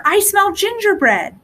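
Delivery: put-on a boy catching a sweet scent; excited little boy. Hansel tells his sister he smells gingerbread, eager.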